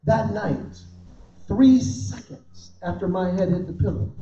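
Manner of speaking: ordinary talk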